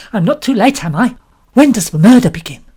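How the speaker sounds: bubbling over with excitement; eager chatter. A narrator's reading. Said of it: "beaming all over her round face".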